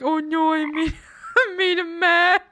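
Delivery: high and loud, laughing; laughing mock panic. Teammate recalls the awkward pause, laughing mock panic. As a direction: play the oops moment. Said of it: "The pause afterwards was like".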